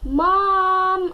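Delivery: high, loud call for mom; a kid calling out loud; yelling for mom. A narrator's reading. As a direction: shout for mom across the room. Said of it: "I yelled".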